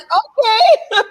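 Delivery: high, giggling okay; a bemused okay through laughter; laughing okay. Retold or imitated. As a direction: laugh the okay out in mock disbelief. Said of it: "and I'm just like".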